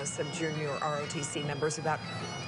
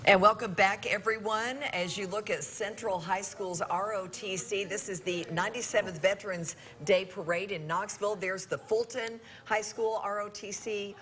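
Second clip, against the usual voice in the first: parade commentator; 4 semitones higher than usual. Acted.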